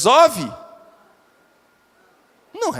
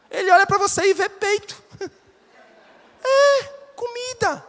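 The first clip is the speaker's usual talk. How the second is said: laughing; 12 semitones higher, 4 dB louder than usual. Real, not acted. Joke to pregnant mothers.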